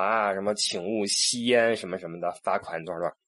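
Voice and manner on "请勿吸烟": slow, stiff notice reading; slow sign reading. Retold or imitated. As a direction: read the sign out deliberately, word by word. (慢吞吞地念告示)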